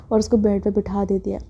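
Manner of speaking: usual speaking voice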